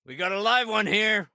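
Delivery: high-pitched call to the team. Acted. Alerting others to a live one, calling out.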